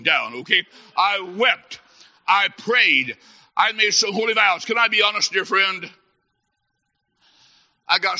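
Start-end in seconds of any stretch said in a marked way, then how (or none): none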